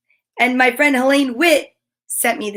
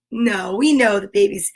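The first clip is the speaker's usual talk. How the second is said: usual speaking voice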